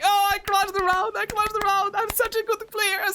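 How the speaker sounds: squeaky mock boast of a happy rival; mocking gloat. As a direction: play the rival as a smug fool. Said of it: "Look, he's so happy".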